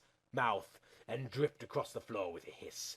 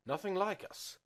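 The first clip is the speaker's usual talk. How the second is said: touch of sadness; 8 semitones higher than usual. Acted. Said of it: "with a hint of sadness in his voice".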